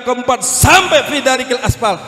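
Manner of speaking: normal talking voice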